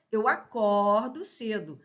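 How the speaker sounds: "acordo" with an open O, as "a-CÓR-do". Shown as a demonstration. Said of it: "ó, aberto".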